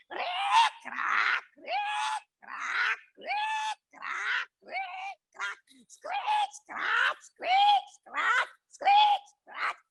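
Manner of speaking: high squeaky creak, crack; creaky rocking chair. A narrator's reading. Narrator mimics the old lady's creaking rocking chair.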